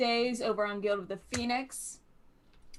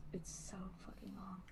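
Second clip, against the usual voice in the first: barely voiced, exasperated whisper; whispered aside; 19 dB quieter than usual. Real, not acted. Streamer aside about their long schedule, whispered. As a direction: whisper it under the breath.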